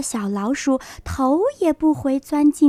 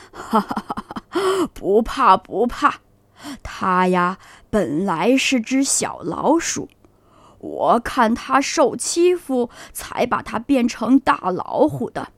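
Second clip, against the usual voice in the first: put-on laughing old grandpa; 3 semitones lower than usual. Grandpa reassures the frightened animals, low and laughing. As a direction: chuckle and calm the little ones.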